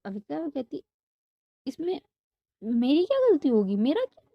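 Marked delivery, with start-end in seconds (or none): 1.7-4.3 high, louder startled protest; indignant protest. Acted. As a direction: protest your innocence, shocked. Avika protests, scared of Radhika. (ऊँची, ज़ोरदार हैरान सफ़ाई)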